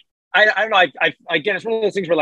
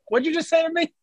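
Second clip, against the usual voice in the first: ordinary talk, nothing marked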